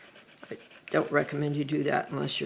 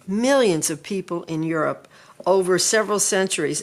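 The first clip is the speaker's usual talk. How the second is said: ordinary delivery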